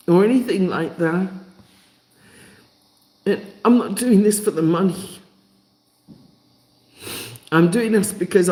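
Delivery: impassioned and choked up, pausing often; crying. Real, not acted. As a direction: push each phrase out through real tears. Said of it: "Can't stop crying".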